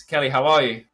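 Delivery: ordinary talk, nothing marked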